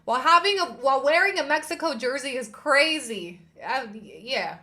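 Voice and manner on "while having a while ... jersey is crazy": loud, high outraged outburst. Real, not acted. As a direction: let the outrage burst out. Outraged rant about slur.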